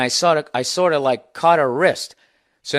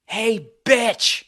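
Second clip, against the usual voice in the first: higher mock female holler; imitated yelling woman; 8 semitones higher, much slower than usual. Angry woman calls him out across the bar, mock holler. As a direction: call out like the angry woman, half-acted. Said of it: "this woman yelling".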